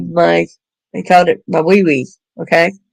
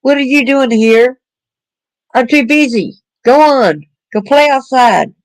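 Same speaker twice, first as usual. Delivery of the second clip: higher-pitched mock scolding of a child; reprimanding parent; 5 semitones higher than usual. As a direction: brush the kid off like an impatient parent. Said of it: "Don't reprimand them like".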